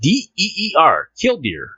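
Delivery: reading the news to the listeners, playful announcer; mock news read. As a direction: announce the story grandly, slowly. Reads news article aloud.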